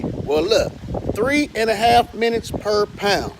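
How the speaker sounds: usual speaking voice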